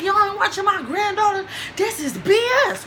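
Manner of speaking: grandma scolding the staff; livid grandma. Retold or imitated. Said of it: "My grandma was livid".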